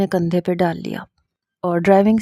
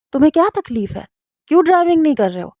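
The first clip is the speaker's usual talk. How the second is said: much higher, rushing fast; angry outburst; 7 semitones higher, faster than usual. A narrator's reading. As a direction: explode at the driver, speeding up. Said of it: "سرفراز غصے میں آ گئے". (بہت اونچی تیز غصیلی آواز)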